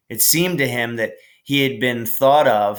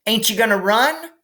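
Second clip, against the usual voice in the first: put-on high, eager little girl calling; little sister voice; 11 semitones higher, 4 dB louder than usual. Little sister Maybell calling to her brother, high and eager. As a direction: pipe up like a small sister.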